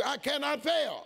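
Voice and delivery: slow, bold declaration; the preacher adds a little attitude. Shown as a demonstration. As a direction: say it like you mean it. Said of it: "With a little attitude".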